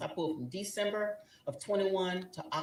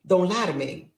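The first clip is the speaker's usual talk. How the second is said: final warning; 2 semitones higher, 7 dB louder than usual. Retold or imitated. Wife giving husband one last chance to confess. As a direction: lay down the ultimatum. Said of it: "I said".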